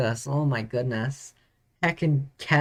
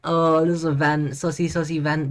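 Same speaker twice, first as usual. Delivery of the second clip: ordinary talk, nothing marked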